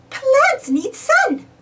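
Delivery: very high, slow Minnie. Acted. Minnie explaining to Donald, very high and slow. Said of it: "said Minnie".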